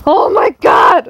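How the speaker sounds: high, loud shocked cry; startled scream. Real, not acted. Player to viewers after a jump scare, screaming. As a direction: cry out after a bad fright. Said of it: "I didn't mean to scream".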